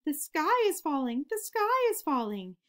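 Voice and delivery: high, loud panicked cry of a frightened chick; panicked scream. A narrator's reading. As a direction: shriek it like the sky is really falling. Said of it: "Chicken Little and Henny Penny screamed".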